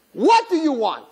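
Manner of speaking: irritated at the interruption; Satan exasperated; annoyed Satan. Retold or imitated.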